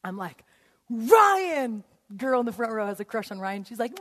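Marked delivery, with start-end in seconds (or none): 0.9-1.8 high, loud name call; crazed shout. Acted. Teacher singling out a student, shouting. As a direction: holler the name across the room. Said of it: "the point that I got crazy in the class".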